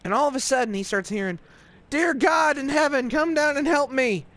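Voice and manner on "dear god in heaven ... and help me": high-pitched pleading call; desperate cry to heaven. Retold or imitated. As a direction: call out like a frantic zealot. Richard crying out, heard from afar.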